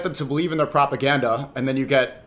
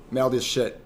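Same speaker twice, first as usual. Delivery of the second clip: ordinary talk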